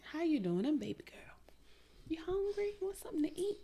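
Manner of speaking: put-on soft whispery country accent, rising high. Body part offering food to a lover, whispered country accent. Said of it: "With a country accent".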